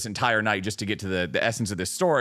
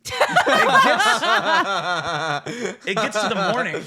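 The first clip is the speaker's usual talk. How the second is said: higher, real laughter breaking up the words; laughing while talking; 7 semitones higher, 6 dB louder, slower than usual. Real, not acted. Storyteller resuming after his friend's joke, really cracking up. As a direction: try to carry on through helpless laughter.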